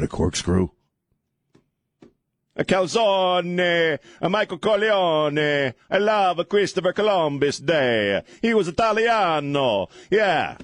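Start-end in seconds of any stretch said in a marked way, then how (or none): 2.6-10.6 slow, higher hammed-up Italian accent; cheap Italian accent. Host celebrating Columbus Day in a cheap Italian accent. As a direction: stretch out calzone like a cartoon Italian. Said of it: "by talking in a cheap Italiano accent".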